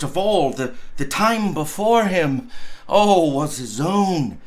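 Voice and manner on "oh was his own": usual speaking voice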